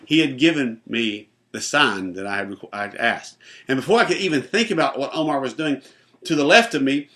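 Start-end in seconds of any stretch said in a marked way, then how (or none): none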